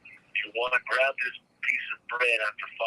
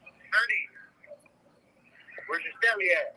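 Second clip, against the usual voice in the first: much higher, sing-song call to Ernie; high pet-talk call; 11 semitones higher than usual. Real, not acted. Speaker calling out to Ernie, high and sing-song.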